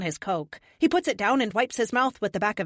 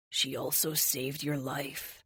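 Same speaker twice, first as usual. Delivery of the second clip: soft low whisper, close and gentle; 4 semitones lower, 7 dB quieter, slower than usual. Acted. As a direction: breathe it quietly into a hug. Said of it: "he whispers".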